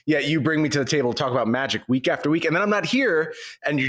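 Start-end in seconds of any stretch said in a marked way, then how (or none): none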